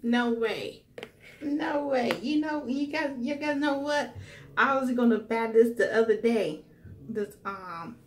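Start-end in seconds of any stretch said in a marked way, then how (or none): 0.0-6.6 excited. Real, not acted. Unboxing an unexpected gift.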